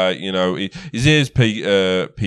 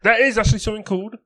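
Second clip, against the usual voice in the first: high, fast put-on accent; Sildar's accent; 9 semitones higher, faster than usual. Sildar explaining dwarf nap to the party, high fast accent. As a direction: rattle it off in the high character voice. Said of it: "my bloody accent's going".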